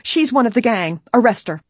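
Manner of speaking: loud, high accusation; crying out. A narrator's reading. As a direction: call it out in alarm, demanding action. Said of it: "the lunchroom woman cried out".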